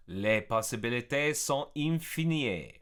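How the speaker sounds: careful French with an English speaker's accent; French, sounded out in an anglophone accent; halting amateur French. Real, not acted. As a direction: read it out in tentative learner's French. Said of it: "My French is pretty bad".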